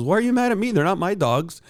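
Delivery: high, loud whiny protest; mock the dog owner's indignant whine. Dog owner whining, retold.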